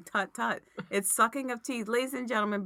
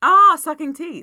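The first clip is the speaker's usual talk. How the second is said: excited; 9 semitones higher, 15 dB louder, slower than usual. Pretend. Loud mock teeth sucking.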